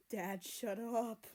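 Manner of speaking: put-on slow, drawn-out teen groan; embarrassed groan. A narrator's reading. Teenage son groaning at his dad, embarrassed. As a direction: groan it, mortified. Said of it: "America groaned".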